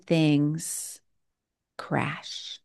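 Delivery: hushed, whispered last word. Real, not acted. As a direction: let the word fall to a whisper. Preacher drops to a whisper.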